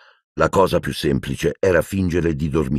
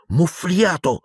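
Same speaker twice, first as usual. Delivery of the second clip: ordinary delivery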